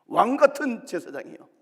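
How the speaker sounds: loud, high proclamation; proclaiming. Real, not acted. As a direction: declare it boldly from the pulpit. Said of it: "preaching scripture at full force".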